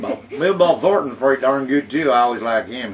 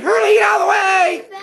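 high shout; 4 dB louder than usual. Retold or imitated. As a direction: yell a warning, high and loud. Shouted movie quote.

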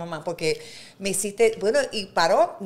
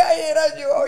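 higher, louder mock whimper; embarrassed wail; 7 semitones higher, 7 dB louder, slower than usual. Admitting to the women she was the screamer, mock whimper. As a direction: whine it like caught out. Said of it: "Yo le dije".

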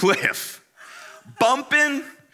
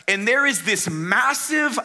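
normal talking voice

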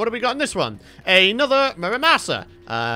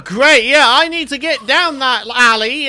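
ordinary talk

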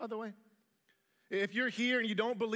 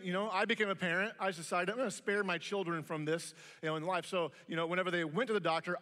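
ordinary delivery

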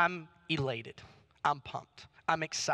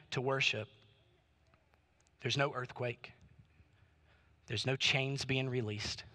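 hushed preaching; 4 semitones lower than usual. Acted. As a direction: bring it right down, measured and earnest. Preacher drops to hushed teaching.

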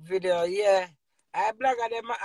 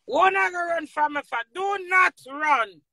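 calling out; 8 semitones higher, 6 dB louder than usual. Real, not acted. Calling after someone running.

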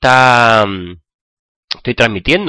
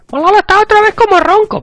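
much higher, louder cartoon voice; squeaky sidekick voice; 5 dB louder than usual. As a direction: tease him in a chipmunk squeak. Squeaky sidekick voice teases him. (voz de vocecita aguda burlona)

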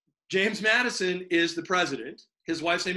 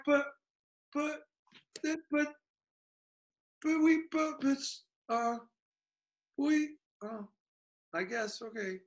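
high, soft stammering that fades out; sputter, lose the argument, give in; flustered British; 8 semitones higher, 6 dB quieter than usual. Acted.

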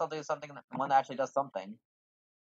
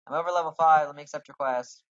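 louder, higher exasperated protest; annoyed that the quest is locked, louder; indignant; 4 semitones higher, 10 dB louder than usual. Real, not acted. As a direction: complain at the game. Repeats the complaint.

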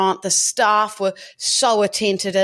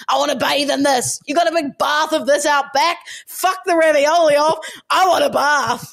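excited mock demand; 5 semitones higher than usual. Retelling asking the waiter for a bath, giddy and higher. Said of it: "And I was like".

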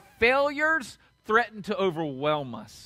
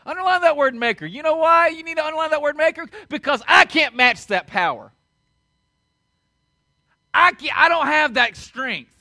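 raised, louder pulpit voice; forceful preaching; 6 semitones higher, 7 dB louder than usual. Real, not acted. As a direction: drive it home to the congregation.

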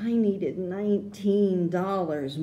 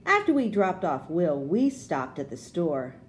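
ordinary delivery, nothing marked

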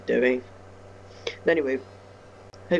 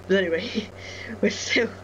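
slightly breathless, higher voice; out of breath; 6 semitones higher than usual. Real, not acted. Gamer resuming the video after running the stairs, out of breath. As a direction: carry on while catching your breath. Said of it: "Don't know why I'm out of breath from that".

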